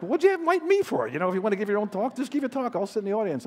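hammed-up annoyed speaker complaining; put-upon guest speaker venting, high and loud; exasperated retort. Speaker's irritation at moderators.

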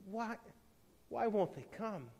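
hushed bewilderment; dismayed question. A narrator's reading. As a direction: ask quietly, pausing after the first word. Woman asks stranger in dismay.

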